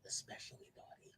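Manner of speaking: breathy, teasing stage whisper. Acted. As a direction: whisper "naughty" like a secret. Teasing whisper about naughty.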